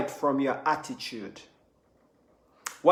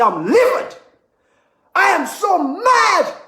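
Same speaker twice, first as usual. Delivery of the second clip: high, loud, slow furious outburst; a demo of a livid, raised voice; raised angry voice; 12 semitones higher, 5 dB louder than usual. Shown as a demonstration. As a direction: blow up at someone in rage. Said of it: "So the voice is there, they've raised their voice".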